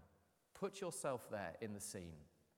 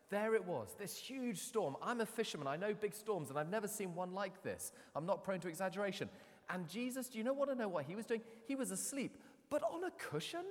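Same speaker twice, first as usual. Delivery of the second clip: much higher, excited fisherman telling the tale; Peter the fisherman retelling; 8 semitones higher than usual. Retold or imitated. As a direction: play Peter, still amazed by the storm. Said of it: "He's saying".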